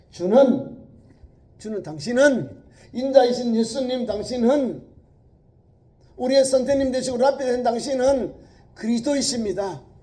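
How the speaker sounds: loud, high, fervent confession; Peter's confession. Acted. Peter confessing Jesus as the Christ, loud and high. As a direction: proclaim it with all your heart. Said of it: "여기 고백이 나와요".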